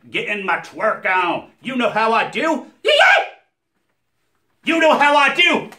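high, shouted hype character voice; Earl voice. Acted. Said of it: "Earl is not easy on the voice box".

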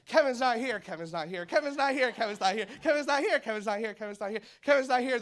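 high, loud frantic chorus; frantic family repeating it; mock panic. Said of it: "they're like".

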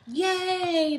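high, loud cheer. A narrator's reading. Crowd cheering the plan, high loud yay. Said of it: "they cheered".

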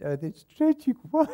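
mock tragic, breaking into laughs; laughing mock sympathy. Mock sympathy to leaving member.

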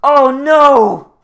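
mock shocked cry. Real, not acted. Player voicing the box reveal as a hammed-up shocked cry. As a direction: shriek it like a horror twist.

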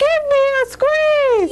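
high, squeaky sing-song line; really high voice. Shown as a demonstration. Teacher showing the class, in a really high voice. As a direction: lift the voice way up into a tiny chant. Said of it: "Now with a really high voice like this".

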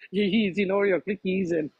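laughing at the joke; amused laugh. Real, not acted. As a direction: chuckle it out, amused. Amused at the Oreo analogy.

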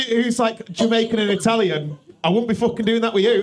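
ordinary talk, nothing marked

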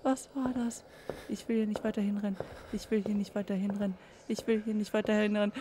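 soft, anxious repeating; hushed nervous mantra; scared hushed muttering. Real, not acted. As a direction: repeat it under your breath in dread. Scared, not wanting to go on.

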